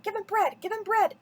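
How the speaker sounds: loud, high, furious cry of disgust; disgusted shout. A narrator's reading. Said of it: "shouted in disgust".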